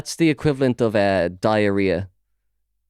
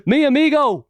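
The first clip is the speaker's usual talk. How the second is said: high, loud mock of Spanish in an Irish accent; imitating an Irish tourist's Spanish; 4 dB louder than usual. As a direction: shout it like a tourist. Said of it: "It's in an Irish accent".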